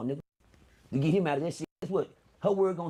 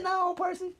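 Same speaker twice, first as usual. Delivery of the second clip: high mock mother voice; mama's high voice; 13 semitones higher, much slower than usual. Mama refusing her son, mock high voice. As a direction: answer him high as mama.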